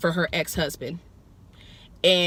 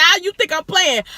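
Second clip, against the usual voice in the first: higher and louder, daring her to doubt it; sassy challenge; 11 semitones higher, 7 dB louder than usual. Retold or imitated. Friend insisting she's not joking, sassy and loud. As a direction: dare her to think you're joking. Mock-serious retort to friend.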